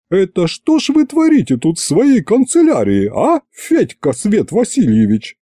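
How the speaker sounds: put-on high indignant old woman; angry witch. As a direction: scold them in outrage. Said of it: "разгневанной тётки Ягуты". (разгневанная Ягута)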